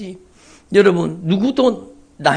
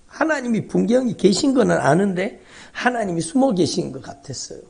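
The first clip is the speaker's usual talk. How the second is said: normal talking voice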